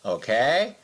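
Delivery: usual speaking voice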